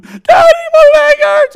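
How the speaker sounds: put-on very loud, piercing child's wail; high-pitched cry. Hurt son calling for his dad, high-pitched wailing cry. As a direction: scream it like a hurt little boy. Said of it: "really high-pitched cry".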